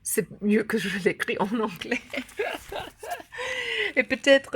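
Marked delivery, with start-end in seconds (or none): 0.0-2.0 amused laughing through the words. Real, not acted. Laughing at her own slip. 2.1-3.9 high embarrassed giggle; a high laugh at the slip. Real, not acted. Laughs after her slip.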